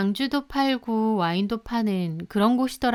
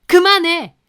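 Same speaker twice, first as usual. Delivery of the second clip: much higher and louder bellow; mother shouting the quarrel down, much higher and louder; furious shout; 8 semitones higher, 11 dB louder than usual. Retold or imitated. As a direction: roar it to stop everyone. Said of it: "그렇게 고함을 치셨습니다".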